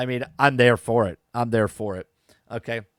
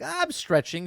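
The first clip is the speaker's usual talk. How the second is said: squeaky high excuse; sudden high-pitched fib; high squeak; 11 semitones higher than usual. Retold or imitated. Daughter caught, excuse to mom.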